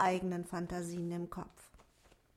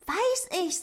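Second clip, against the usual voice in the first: higher small dwarf voice; little dwarf; 10 semitones higher, 4 dB louder than usual. A narrator's reading. Dwarf Otto answers the rabbit in a higher voice.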